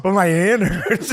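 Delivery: hammed-up pained whine; mock whiny mom. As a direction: whine it like a dazed hurt mom. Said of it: "she was like".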